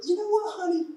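a wife cooing praise at her handy husband; mock wife praising. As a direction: gush like an admiring wife. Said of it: "we want to think that we're".

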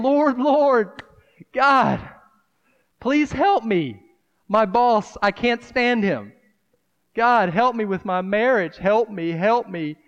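a hammed-up desperate believer begging God; mock desperate plea. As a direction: cry out to God like you're at wit's end. Mock desperate prayer for help.

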